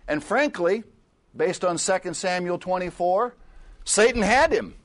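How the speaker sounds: high, loud full-force preaching; fiery sermon. Acted. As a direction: drive the point home to the congregation.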